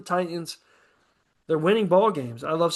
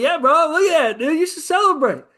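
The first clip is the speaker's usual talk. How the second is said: higher and louder, hyped-up cheering; excited; 9 semitones higher, 5 dB louder than usual. Retold or imitated. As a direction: pump your family up to celebrate. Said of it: "I'm like".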